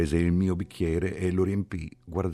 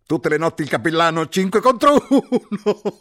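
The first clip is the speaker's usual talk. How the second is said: exclaimed jeer; 12 dB louder than usual. A narrator's reading. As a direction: crow the joke at the chaplain. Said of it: "ed esclamò".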